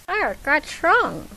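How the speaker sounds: slow, tight-jawed through gritted teeth; through clenched teeth. Acted. Chloe asks Clark through clenched teeth. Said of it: "said through clenched teeth".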